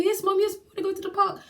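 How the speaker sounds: put-on high, loud child voice; excited kids. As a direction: cheer yes like an eager little kid.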